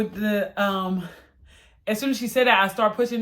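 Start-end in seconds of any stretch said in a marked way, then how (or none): none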